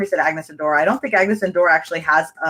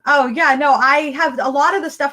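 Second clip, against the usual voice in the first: bursting with excitement, much higher; excited; 7 semitones higher than usual. Real, not acted. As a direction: gush thanks to Lauren, pitched way up. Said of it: "I'm just so excited".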